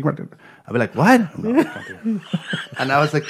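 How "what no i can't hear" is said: laughing. Retold or imitated. Mock confusion at hearing test.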